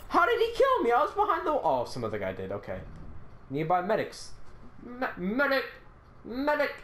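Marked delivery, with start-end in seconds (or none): 0.1-1.5 high, loud disbelief; shocked outburst. Real, not acted. Just killed in game. 4.8-6.7 yelling for a medic; man down shout. Real, not acted. Downed, calling for a medic.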